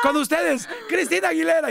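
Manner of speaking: high, grand announcement; high showman intro; mock announcer. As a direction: present the star to the crowd.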